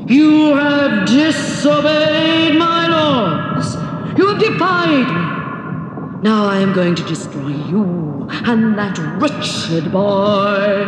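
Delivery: slow, booming wrathful threat; enraged monster's drawn-out roar; angry mountain giant. Acted. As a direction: thunder out judgement, stretching the words. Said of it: "he shouted angrily".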